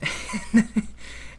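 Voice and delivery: the ego laughing at your outburst with a breathy, unvoiced laugh; breathless laugh. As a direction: let out a quick, breathy laugh of amusement. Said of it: "and then it laughs".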